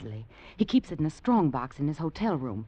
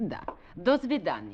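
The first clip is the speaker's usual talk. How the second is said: fake Russian accent; 4 dB quieter than usual. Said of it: "What happened to your Russian accent".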